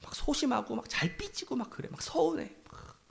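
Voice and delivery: hushed, sheepish whisper; whispered confession. Real, not acted. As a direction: let them in on a little secret. Confessing he sulks easily.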